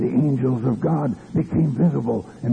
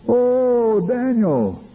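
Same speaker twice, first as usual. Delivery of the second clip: much higher, drawn-out call that falls away; high crying out; 11 semitones higher, much slower than usual. Retold or imitated. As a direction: cry out for Daniel in a high, long wail. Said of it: "he cried out".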